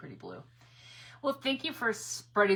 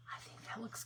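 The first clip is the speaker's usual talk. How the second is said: whisper; 2 semitones higher, 15 dB quieter than usual. Real, not acted. Quiet aside while painting.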